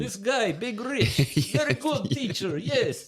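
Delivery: high, fast sales pitch; hyped-up recruiter voice. Pretend. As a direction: sell Big Rich like a salesman. Voicing a recruiter.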